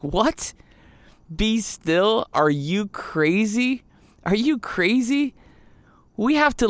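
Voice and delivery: high, rising disbelief, are you crazy; incredulous outburst. Pretend. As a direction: pitch climbing in panicked disbelief. Imagined Israelites react to Moses.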